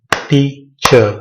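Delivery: टीचर slowly as tea, pause, cher; two separate beats on टीचर; split into syllables. Shown as a demonstration. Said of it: "तमाम जो है".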